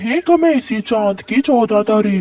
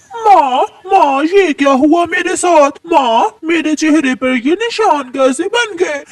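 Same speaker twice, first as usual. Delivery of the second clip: panicked wail; 6 semitones higher than usual. Acted. Daughter finds burns on face.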